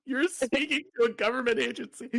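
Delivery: high, helpless laughter through the words; real giggling disbelief; laughing. Real, not acted. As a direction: squeeze the question out while laughing. Laughing at the caller story.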